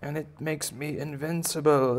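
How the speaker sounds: high, slow-stretched gloating bunny voice; boastful baby bunny. Acted. Bunny boasting of being invincible, high drawn-out gloat. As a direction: gloat high, drawing out key words. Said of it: "And he's like".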